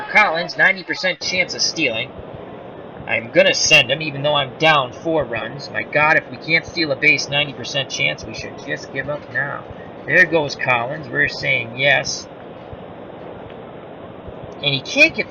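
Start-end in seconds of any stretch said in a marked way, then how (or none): none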